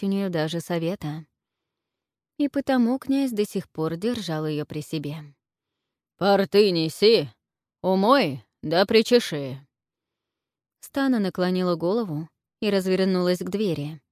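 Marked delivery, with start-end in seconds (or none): 6.2-9.6 loud gruff commands; commanding order. A narrator's reading. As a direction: bark orders at the servant. Князь приказывает служанке. (властный приказ)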